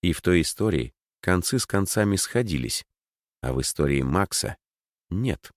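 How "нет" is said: ordinary delivery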